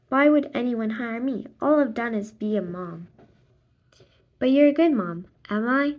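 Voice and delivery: plain reading voice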